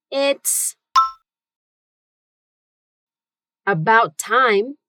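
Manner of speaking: broken into slow chunks; slow word by word. Shown as a demonstration. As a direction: pause between the phrases. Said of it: "Say it with me".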